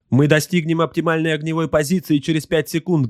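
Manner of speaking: high-pitched subordinate's report; high-voiced crew slave reporting; servile gunnery slave. A narrator's reading. War slave reports firing position.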